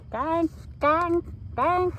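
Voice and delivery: big ducks calling from the pond; big duck dink. A narrator's reading. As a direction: call it out like a bold duck. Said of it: "The big ducks say".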